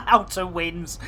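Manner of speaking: Outer Winds said through laughter; laughing. Real, not acted.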